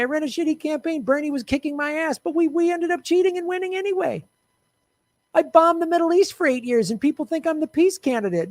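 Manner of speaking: high, fast mocking Biden voice; hammed-up smug Biden impression; mocking Joe Biden. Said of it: "Joe Biden is like".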